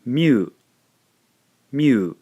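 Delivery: mu said as one glide; sound myu. Shown as a demonstration. Teacher modelling the blended sound myu. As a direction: blend me and you into mu. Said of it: "we end up with".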